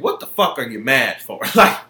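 ordinary talk, nothing marked

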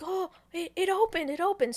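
high-pitched, excited discovery; acted breathless surprise, repeating it eagerly; amazed excitement. Retold character astonished at opening stone.

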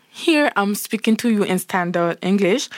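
ordinary talk

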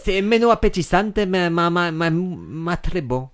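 slow, hammy fake Italian; fake Italian accent. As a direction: ham up a fake Italian salesman. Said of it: "tu parles très bien le faux italien".

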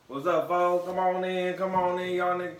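high, loud hype welcome; loud showman welcome; calling folks in. Acted. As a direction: hype up the crowd like a host at the door. Host welcoming listeners, calling out.

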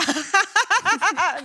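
high evil villain cackle; rire machiavélique. Pretend. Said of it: "C'est un rire machiavélique". (rire diabolique de méchant)

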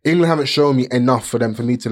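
ordinary talk

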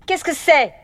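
high, sharp headmistress demand; indignant authority, pitched high; thundering headmistress. A narrator's reading. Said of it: "tonna la directrice".

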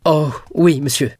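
high, loud, eager agreement; grateful exclamation. A narrator's reading. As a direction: burst out with thanks. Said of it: "s'écria Dantès". (exclamation reconnaissante)

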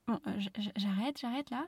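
sudden soft stammer; calming down. Real, not acted. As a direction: pull yourself back, sheepish. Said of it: "je me calme". (se calme en bafouillant)

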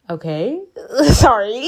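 half-laughing, hammed-up hurt apology; mock whimper with a questioning lift; mock-tearful apology. Patient apologizing to the doctor, mock-tearful.

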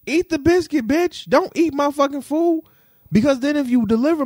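very high, heated repeat; upset outburst. Real, not acted. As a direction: hammer it at him, shrill with anger. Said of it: "But I was very upset".

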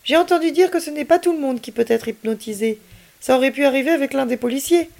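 put-on young boy voice. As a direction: voice the doubtful boy. Child figure's voice.

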